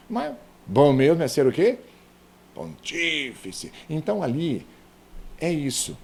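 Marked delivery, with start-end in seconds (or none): 2.5-3.7 "Pontífice" with a long, drawn-out TÍ; slowly impose the stress on TÍ in "Pontífice"; stressed syllable stretched. Shown as a demonstration. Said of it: "imposição de uma acentuação dentro da palavra". ("Pontífice" bem devagar, alongando o TÍ)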